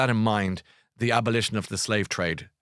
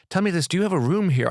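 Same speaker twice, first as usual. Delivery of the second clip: a light, higher mock of Laura Whitmore; imitating Laura; 8 semitones higher, 4 dB louder than usual. Retelling Laura Whitmore's question at the gig, lightly voiced higher. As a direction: toss the question brightly, half-teasing. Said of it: "and she said".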